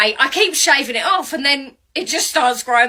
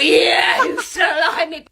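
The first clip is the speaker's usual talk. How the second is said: mock outrage; 7 semitones higher, much slower than usual. Cursing her dad's ginger genes, mock yell.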